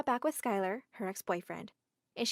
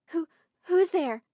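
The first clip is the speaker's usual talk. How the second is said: slow, high, louder scared call; frightened call; 6 semitones higher, 8 dB louder, slower than usual. Acted. Girl calling into the dark office, afraid. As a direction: call out nervously.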